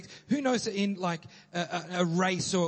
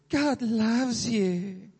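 slow, gentle reassuring line; a hint of Joel Osteen crooning comfort; soft feel-good preacher; 5 semitones higher, much slower than usual. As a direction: coo it like a TV pastor. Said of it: "who goes".

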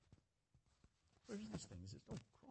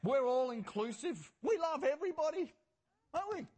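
raised, high pulpit proclamation; ironic preaching; 7 semitones higher, 5 dB louder than usual. Real, not acted. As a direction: proclaim it loudly to the church with irony. Mocking the congregation from pulpit.

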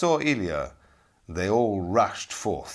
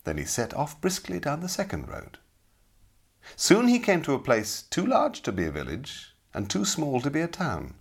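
ordinary delivery

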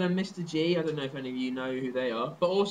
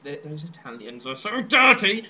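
mock outraged bigot; 7 dB louder than usual.

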